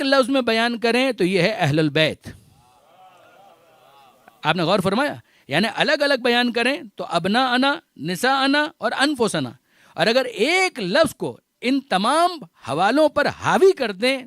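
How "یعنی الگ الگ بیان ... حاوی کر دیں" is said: rising sermon fervor. Acted. Preacher proclaiming the three names to the congregation, fervently. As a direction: call out the three words like a proclamation.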